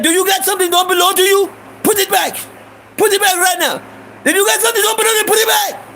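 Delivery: very high, agitated shopkeeper, speeding up at the end; mad Iranian shopkeeper. Retold or imitated. Shopkeeper orders young thief to return gum, imitated very high and mad. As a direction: demand the goods back in a high, mock-angry voice. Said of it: "He getting mad".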